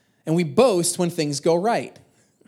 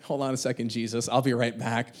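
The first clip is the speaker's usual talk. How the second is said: low, soft aside in a retold prayer; hushed aside; 9 semitones lower, 6 dB quieter than usual. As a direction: excuse yourself quietly from praying. Excusing himself from prayer.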